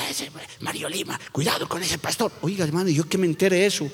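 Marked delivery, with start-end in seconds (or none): 0.1-2.3 hushed, secretive aside; a sly whispered warning; gossipy whisper. Retold or imitated. Voicing gossip about a pastor.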